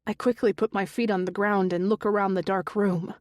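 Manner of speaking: plain reading voice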